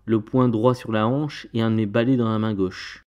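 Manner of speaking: ordinary delivery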